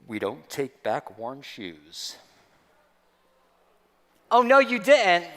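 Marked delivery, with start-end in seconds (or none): 0.1-2.2 much lower and slow, flat refusal of a snooty clerk; deep clerk voice. Retold or imitated. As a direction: drop low and slow like a stiff store clerk. Voicing the clerk Evan.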